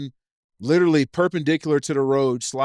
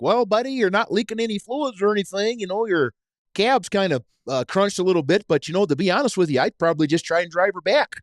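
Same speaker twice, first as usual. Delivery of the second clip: higher-pitched, folksy Wisconsin impression; thick Wisconsin accent; 4 semitones higher than usual. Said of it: "in his thick ass Wisconsin accent".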